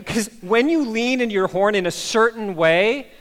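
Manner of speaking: ordinary talk, nothing marked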